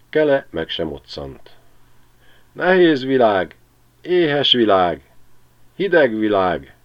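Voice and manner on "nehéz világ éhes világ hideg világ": put-on high, slow, chirping old sparrow; csiripelő öreg veréb. A narrator's reading. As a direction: lament the winter like a tiny old bird. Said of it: "csiripel tovább az öreg veréb". (magas, lassú, panaszos verébhang)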